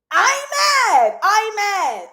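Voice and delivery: high, loud, slow, grinning; swooning delight. Real, not acted. Host swooning over the kiss, delighted. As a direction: gush it out.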